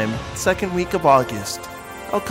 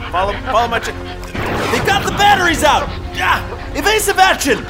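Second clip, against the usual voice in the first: battle shouting; 10 semitones higher than usual. Acted. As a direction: shout orders over the dogfight. Dogfight battle commands.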